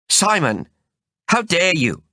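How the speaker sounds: how dare you, hurled at him; a flash of fury; indignant anger. Acted. She glares at him, furious.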